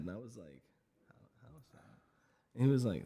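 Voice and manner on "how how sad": retold in a very soft voice; hushed mock concern; small worried question. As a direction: ask it almost under your breath. Timid question to guru, retold.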